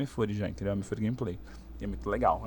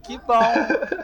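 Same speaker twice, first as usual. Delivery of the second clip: laughing; 10 semitones higher, 7 dB louder than usual. Retold or imitated. Moved reply to fan, laughing.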